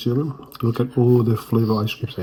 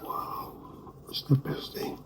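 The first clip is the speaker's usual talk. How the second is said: hushed, breathy whisper; whispering over a crib; 13 dB quieter, slower than usual. Real, not acted. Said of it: "We're whispering because the baby's asleep".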